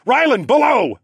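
loud high command; father ordering his son below deck; barked order. A narrator's reading. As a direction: bark the order at him. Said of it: "I barked".

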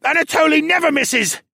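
high, loud, frantic cries; panicked shouting. A narrator's reading. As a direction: shout in terror, struggling to get free. Bound prisoner panics after arrow kills partner.

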